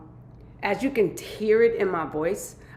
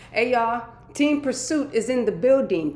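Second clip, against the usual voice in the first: higher and louder, fired-up greeting; hype shout-out; 5 semitones higher, 5 dB louder than usual. Real, not acted. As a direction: call out to the crowd like an MC.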